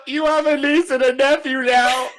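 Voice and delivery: loud, high-pitched mock wail; tearful yell. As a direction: blurt it out in a hammed-up, laughing cry. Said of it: "Just yells at Ben".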